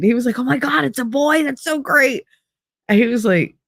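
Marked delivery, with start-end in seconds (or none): 0.4-2.2 higher-pitched retold delight; excited. Friend learning it's a boy, excited. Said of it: "he was so excited".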